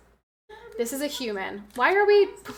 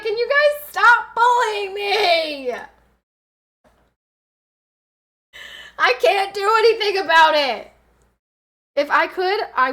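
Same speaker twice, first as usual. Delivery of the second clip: mock whine; 7 semitones higher, 7 dB louder, slower than usual. Teased about spit bottle.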